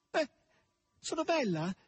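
high-pitched excited cry; hoarse cry. A narrator's reading. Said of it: "gridò forte con voce arrochita".

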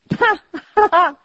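loud, high burst of laughter; acted laugh. A narrator's reading. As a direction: break into a big laugh mid-speech. Nephew laughs in mid-speech.

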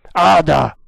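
loud cry of a name; exclaiming. A narrator's reading. Captain repeats his daughter's name, loud exclamation.

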